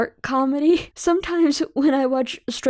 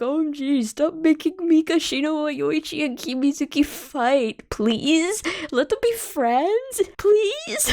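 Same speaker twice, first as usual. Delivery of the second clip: whiny begging, climbing higher at the end; whining plea; 4 semitones higher than usual. Retold or imitated. Fan begging the show to stop the fighting, whining. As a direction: plead like a pouting fan, drawing out the end. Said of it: "I was always whining".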